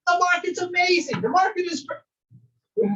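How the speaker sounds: hammed-up overeager agent gushing about the market; over-enthusiastic. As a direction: oversell it like a giddy salesman. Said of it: "They get over enthusiastic and they're like".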